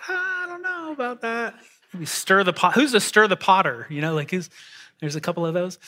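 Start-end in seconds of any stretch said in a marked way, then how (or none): 0.1-1.5 high mock-doubtful squeak; a sly, laughing bit of doubt; doubtful aside. Pastor joking about peacemaker hands, high mock doubt. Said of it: "that I was like".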